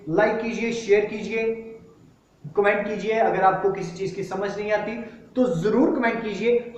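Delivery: brighter, pushing call to action; upbeat outro plug. Acted. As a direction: sell the like and share. Channel outro pitch.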